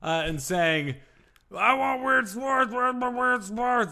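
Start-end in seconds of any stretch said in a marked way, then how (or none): 1.5-3.9 higher, slow whiny fan voice. Retold or imitated. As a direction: whine like a demanding fan. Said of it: "That's what their voice sounds like".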